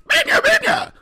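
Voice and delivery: very high, loud squealed character voice; shrill-high cartoonish kids' show shout; Amanda Bynes impression. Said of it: "I can do this".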